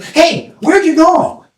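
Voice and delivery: high-pitched call to a stranger. Acted. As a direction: hail the person ahead. Calls to last in line.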